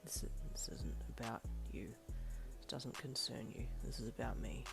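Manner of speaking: low, hushed and dark; dark, low reply. A narrator's reading. Aizawa turning away from her, low and dark. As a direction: brood it out quietly. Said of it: "he replied darkly".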